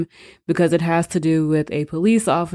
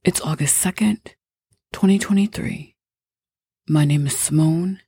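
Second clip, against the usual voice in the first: whispering. Acted. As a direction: whisper it like a secret. Whispered cold open intro.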